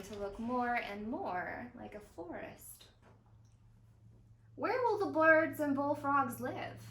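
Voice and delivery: gentle read-aloud narration with voiced dialogue; storybook reading. A narrator's reading. Zoo educator reading a story to young viewers, gentle storytelling. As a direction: read it like a picture book for kids. Reads picture book aloud.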